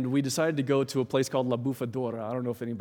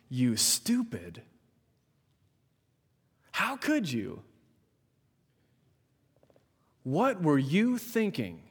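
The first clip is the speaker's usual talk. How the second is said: put-on scolding a child, pitched up sharply; harsh rebuke; 3 semitones higher, slower than usual. Preacher acting out disciples scolding a child, harsh rebuke. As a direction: berate them like a fed-up grown-up. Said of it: "What do you think they said?".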